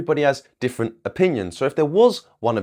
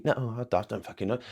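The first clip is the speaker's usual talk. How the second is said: voicing the dog; 9 dB quieter, faster than usual. Coach voicing a confused dog that can't understand the TV, dopey murmur. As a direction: mumble like a baffled dog. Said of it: "He'd just fucking look at me".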